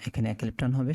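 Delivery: ordinary talk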